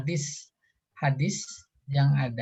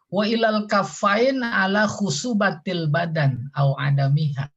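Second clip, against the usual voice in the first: reciting Arabic; 5 semitones higher, 4 dB louder than usual. Shown as a demonstration.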